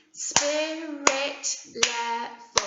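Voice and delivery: very slow, syllable by syllable; syllables pulled apart for clapping; broken into sounds. Shown as a demonstration. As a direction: clap each syllable slowly. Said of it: "Let's break it up into the sounds".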